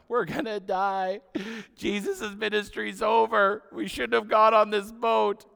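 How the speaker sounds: high, loud, slow mock panic; loud, high, slow mock terror; hammed-up panic. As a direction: wail it like doomed sailors, hammed up. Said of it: "they're all freaking out".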